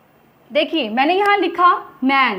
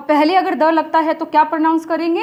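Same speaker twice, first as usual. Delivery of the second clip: normal talking voice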